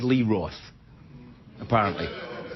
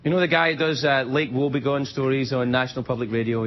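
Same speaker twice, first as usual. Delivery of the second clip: ordinary talk, nothing marked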